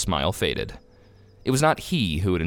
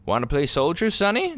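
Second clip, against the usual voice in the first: casual question, pitched up on the last word; 8 semitones higher than usual. A narrator's reading. Clerk to a small boy wanting a uniform, casual. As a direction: toss it off lightly, rising at the end. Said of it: "demanded a clerk, casually".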